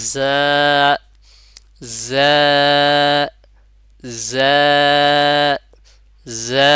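জা said alone with a long buzzing z and drawn vowel; articulation demo. Shown as a demonstration. Teacher showing students where জা is articulated, demonstrative. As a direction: repeat জা firmly, loud, each one separate. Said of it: "উচ্চারণ হবে".

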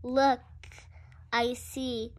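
plain reading voice

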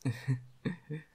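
a light giggling laugh; giggle. Real, not acted. Laughing mid-comfort at her baby boy, light giggle. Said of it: "Forgive me for laughing".

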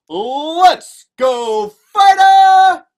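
loud, high rallying shout; crowd cheer. Acted. Crowd cheering on a fighter, big shout. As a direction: yell it like a roaring crowd. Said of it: "the crowd started to cheer".

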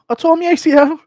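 squeaky defensive insistence; Darren Till excuse. Retold or imitated. Darren Till insists he let his daughter win, squeaky whine. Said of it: "Darren Till explaining".

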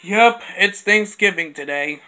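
ordinary talk, nothing marked

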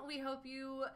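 normal talking voice

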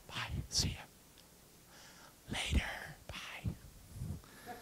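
soft whispered farewells; whispered goodbye. Acted. Jesus whispering goodbye while leaving disciples. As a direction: whisper bye as you drift off.

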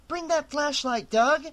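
high urgent call. A narrator's reading. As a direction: call across the room. Calling into dark room.

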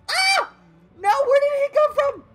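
high loud startled shriek; panicked scream. Real, not acted. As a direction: scream in real shock. Surprised by enemy.

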